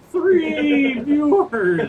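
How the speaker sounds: slow, loud laugh through words. Real, not acted. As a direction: laugh while stretching it out. Laughing at three viewers.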